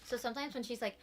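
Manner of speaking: ordinary delivery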